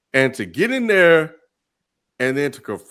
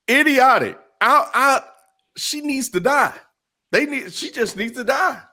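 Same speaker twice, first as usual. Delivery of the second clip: fed-up outburst; 11 semitones higher than usual. Real, not acted. Ranting at the character.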